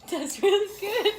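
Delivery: high, loud, slow laughing delight; delighted squeal. Real, not acted. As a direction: squeal it with pleasure.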